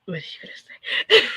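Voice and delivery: whispered, giggling worry; laughing whisper. Retold or imitated. Clients fretting about Carrie's reaction, in a giggling whisper. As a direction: whisper it like a scared kid.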